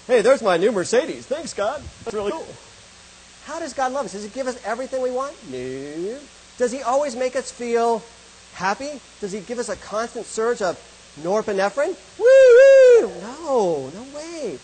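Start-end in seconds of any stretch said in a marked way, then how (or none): 0.1-2.5 mock gimme prayer. Mocking a greedy prayer answered. 12.2-13.1 high, loud hammed-up woo-hoo; mock ecstatic whoop. Mocking constant happiness high.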